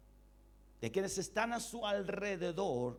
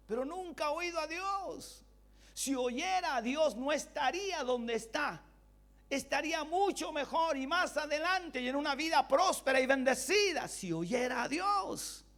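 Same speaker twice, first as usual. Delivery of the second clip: higher and louder, pressing each phrase home; fervent preaching; 11 semitones higher, 5 dB louder than usual. Acted. Pastor warning churchgoers who never hear God, loud fervent preaching. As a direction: preach it hard to wake them up.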